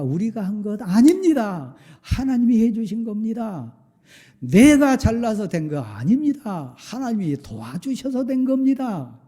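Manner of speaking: loud, high, ringing pulpit voice; fervent preaching. Acted. Pastor insisting to the congregation, loud fervent preaching. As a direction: drive it home to the congregation.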